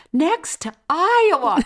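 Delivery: high, loud and slow, proud announcement; proud exclamation. Retold or imitated. Daughter telling her parents she's going near Iowa, high and loud. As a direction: declare it like big news. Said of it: "And she said".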